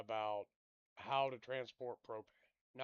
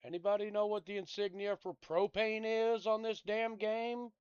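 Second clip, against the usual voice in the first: raised and higher, fed-up shout at the game; exasperated loud question; 9 semitones higher, 8 dB louder than usual. Real, not acted. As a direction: yell out in real frustration. Gamer raging at confusing game.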